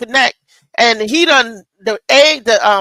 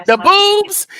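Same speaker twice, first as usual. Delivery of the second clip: exclaimed boobs; 9 semitones higher than usual. Real, not acted. Exclaims about leaking milk.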